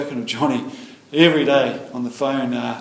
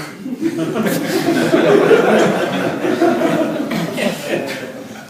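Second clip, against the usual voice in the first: long laugh; 7 semitones higher, 6 dB louder than usual. Real, not acted. Laughing at his own crude joke.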